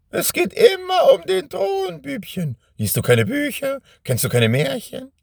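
high, gloating witch voice. Acted. Witch mocks Benjamin. (hohe, überhebliche Hexenstimme)

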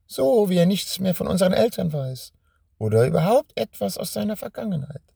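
high, gloating witch voice. Acted. Witch explains her identity. (hohe, überhebliche Hexenstimme)

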